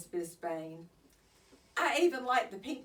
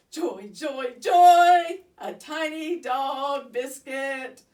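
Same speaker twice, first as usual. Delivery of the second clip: joyful outburst; 5 semitones higher, 13 dB louder, slower than usual. Acted. Hamster thrilled by treat.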